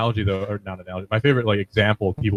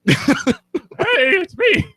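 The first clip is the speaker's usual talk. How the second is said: put-on very high squeaky boy voice; young Keon impression; much slower than usual. Friend doing young Keon's pre-puberty squeak. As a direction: squeak it like a kid before puberty. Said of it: "my impression of young Keon".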